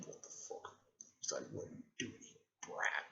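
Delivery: very soft, voiceless fox growl; fox spirit voice. Retold or imitated. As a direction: play the fox under your breath. Voicing the Nine-Tailed Fox.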